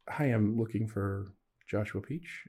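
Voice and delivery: mock whisper down the phone; hushed scheduler voice on the phone, softly. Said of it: "Is she whispering?".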